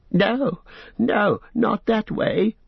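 high pleading creature wail, drawn out; Smeagol wailing. Acted. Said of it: "wailed Smeagol".

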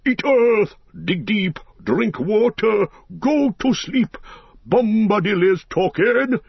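high, sing-song commands; commanding Tom Bombadil. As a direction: order the willow to sleep.

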